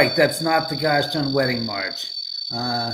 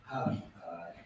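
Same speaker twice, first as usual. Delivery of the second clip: whispering; 8 semitones lower, 12 dB quieter than usual. Acted. Whispered in impression.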